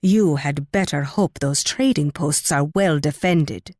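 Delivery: ordinary talk, nothing marked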